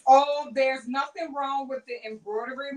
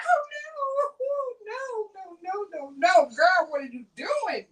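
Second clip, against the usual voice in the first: mock panic; 7 semitones higher, faster than usual. Retelling her stitching mistake, she plays her own mock panic. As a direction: squeal at yourself in mock alarm. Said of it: "I'm like".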